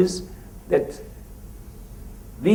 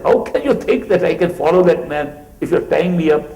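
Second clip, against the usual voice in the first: retold scream; 5 semitones higher, 6 dB louder than usual. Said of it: "He was screaming".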